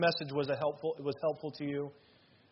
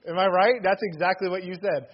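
usual speaking voice

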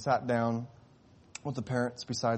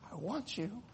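much softer, hushed I want you; quiet first take; 8 semitones higher, 10 dB quieter than usual. Acted. God speaks softly to the sinner. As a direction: breathe it gently. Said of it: "And God said".

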